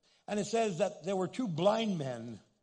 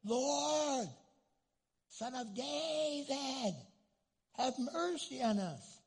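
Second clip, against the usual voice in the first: higher, slow, drawn-out plea; pleading cry; 7 semitones higher, much slower than usual. Retold or imitated. Blind men by the road calling to Jesus, drawn-out plea. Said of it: "they began shouting".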